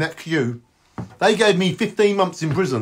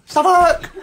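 laughing yell; 12 semitones higher, 4 dB louder than usual. Acted.

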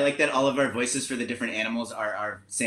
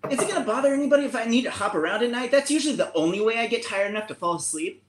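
higher and fast, a restless bunny; a bouncy bunny asking if hopping at night is okay; jittery bunny voice; 10 semitones higher, faster than usual. Acted. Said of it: "trying to go a little bit higher or lower depending on each animal".

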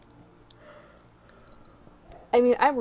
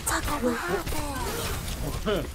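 higher, very slow pet talk; talking to cat; 6 semitones higher, much slower than usual. Retold or imitated. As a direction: coo at the cat slowly. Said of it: "I talk to my cat like".

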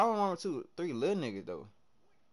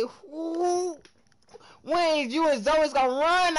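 mocking a pestering viewer; 9 semitones higher, 4 dB louder, slower than usual. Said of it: "if somebody else say".

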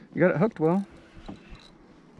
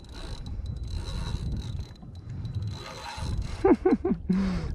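long breathy laugh; 5 semitones higher, 4 dB quieter than usual. Real, not acted.

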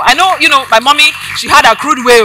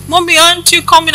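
put-on higher-pitched child's question; a small child's voice, retold; young son pleading; 8 semitones higher than usual. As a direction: lightly imitate a child asking for mother. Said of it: "I still remember Nima".

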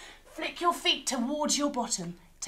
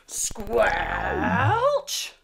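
squelchy step; 3 dB louder than usual. Acted. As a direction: make the mud squelch. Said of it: "another big squelchy step".

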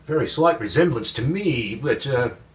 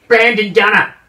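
exasperated repeat; 4 semitones higher, 8 dB louder than usual. Acted. Repeats name, annoyed.

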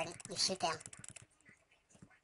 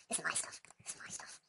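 fading whisper; 4 semitones lower, 10 dB quieter than usual. Pretend. Repeats line, fading to whisper.

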